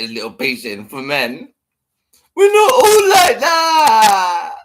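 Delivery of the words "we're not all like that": high, slow crying shout; mock wailing shout. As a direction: wail it as a parody.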